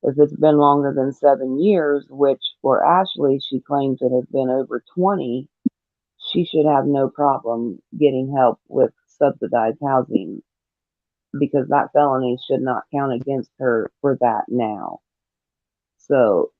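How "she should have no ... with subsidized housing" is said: ordinary talk, nothing marked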